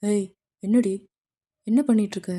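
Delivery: loud, sharp, offended demand; angry outburst. Acted. Namjoon confronting the girl who kissed him, angry and loud. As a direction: snap at her in shocked anger.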